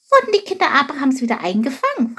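high, rushed, alarmed question; horrified. A narrator's reading. Chilp fears the people were caught, horrified. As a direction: blurt it out in high-voiced dismay. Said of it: "fragte Chilp entsetzt".

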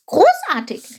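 high, loud delighted exclamation; enthusiastic cry. A narrator's reading. Chilp admires the frogs' feat, high excited cry. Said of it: "rief Chilp".

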